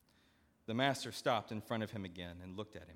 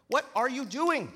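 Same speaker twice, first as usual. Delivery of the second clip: much higher, loud snapping demand; angry demand; 11 dB louder than usual. Acted. Steward storming back at the servant, loud angry demand. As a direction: bark it at him. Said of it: "he demanded".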